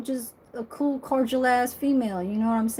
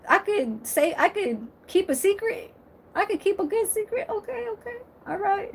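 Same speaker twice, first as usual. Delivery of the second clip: much higher-pitched mock disbelief, broken by laughs; incredulous reaction; 10 semitones higher than usual. Mother reacting to daughter's offered pinky, high mock-incredulous voice. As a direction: squeal it in amused disbelief, settling into agreement. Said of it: "And I'll be like".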